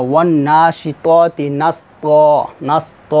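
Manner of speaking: slow recitation with a long final "ত"; "নাস ত" drawn out without an n; one-alif stretch. Shown as a demonstration. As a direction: recite slowly and hold "ত" open. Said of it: "এক আলিফ টান দিতে হবে".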